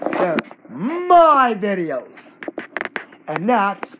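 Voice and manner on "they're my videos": slow and loud, leaning on my; insistent claim. Real, not acted. Kid insisting to the copycat the videos are his, stressing my. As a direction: stress my, drawn out and hard.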